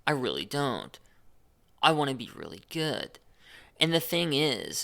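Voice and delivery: usual speaking voice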